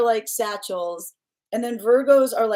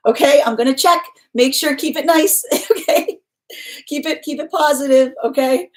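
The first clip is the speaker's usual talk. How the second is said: loud, higher mock-stern insistence; playful warning; 5 semitones higher, 7 dB louder than usual. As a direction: warn them firmly but with a laugh. Playfully warning viewers to be nice.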